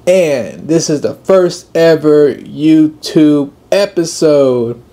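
slow, loud podcast host welcome; big announcer-style welcome; host intro. Acted. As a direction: greet the listeners like a show host.

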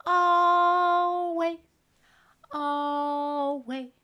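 big drawn-out calling voice; loud call. Acted. Sending the otter away.

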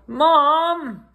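calling for mom. A narrator's reading. Sick child calls his mom for help, high plaintive call. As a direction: cry out for mom from inside. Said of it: "I call".

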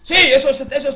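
loud, high burst of triumph; excited shout. Real, not acted. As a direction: yell it out in delight. Celebrating a tactical play.

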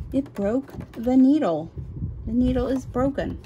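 exasperated as the needle snaps; real irritation at the broken needle; ticked off. Real, not acted. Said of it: "am a little ticked".

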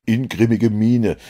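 usual speaking voice

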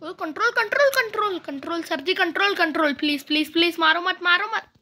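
high, loud, repeated begging; frantic pleading. Real, not acted. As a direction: plead for your life in a panicky rush. Begging not to be killed. (घबराकर गिड़गिड़ाते हुए)

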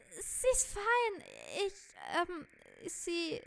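high, drawn-out stammering; defensive game figure. Acted. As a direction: insist in a high voice, then falter.

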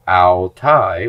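ao tai with no h at the start; teacher shows smooth breathing on ao tai; smooth breathing demo. Shown as a demonstration. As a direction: say ao tai with a clean vowel onset, no aspiration. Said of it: "with a smooth breathing".

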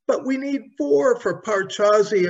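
put-on high, protesting girl's voice; a girl raising an objection; objecting girl. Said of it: "objected Dorothy".

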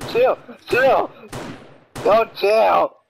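loud panicked shout to stop; yelling chill. Real, not acted. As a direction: yell at them to chill. Being attacked in game.

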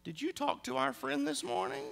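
high, soft suspicious question; a quietly pointed question that already knows; suspicious wife. Retold or imitated. Wife quietly confronting husband about the friend, high and soft. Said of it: "it gets real quiet, and she goes".

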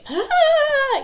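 loud, high mock scream; piercingly high child-style "ahh"; imitated scream. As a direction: cut in with a mimicked tantrum shriek. Daughter's scream cuts mom off.

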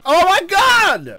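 high, drawn-out yell; loud, high gamer outburst; alarmed shout. Real, not acted. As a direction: shout in disbelief at the game. Panicked reaction to the game.

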